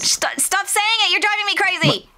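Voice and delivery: high, pleading exasperation; exasperated whine. Real, not acted. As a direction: beg them to stop, exasperated. Friend keeps repeating mahogany.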